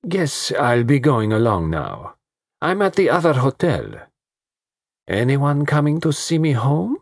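high, teasing vamp voice. A narrator's reading. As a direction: toss it off lazily. Dolores leaving, teasing.

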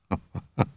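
low-volume demoniacal chuckle; soft, staccato sinister laugh; evil chuckle. A narrator's reading. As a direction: chuckle softly like a lurking demon. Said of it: "an evil, demoniacal chuckle".

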